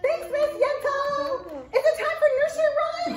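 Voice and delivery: high, bright little monkey puppet; squeaky puppet voice. Acted. Librarian voicing Arturo the monkey puppet to the children, high excited puppet voice. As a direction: pitch way up and chatter like an excited puppet.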